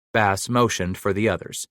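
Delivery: ordinary talk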